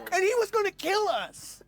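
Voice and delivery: high-pitched exasperated disbelief; incredulous punchline. Real, not acted. Comic telling how the drummer endangered everyone, high incredulous pitch. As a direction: squeal it in disbelief.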